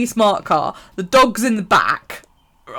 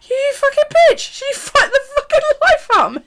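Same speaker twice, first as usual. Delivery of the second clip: high, laughing imitation of a furious friend; mock outrage; 4 dB louder than usual. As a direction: squeal the complaint, barely holding back laughter.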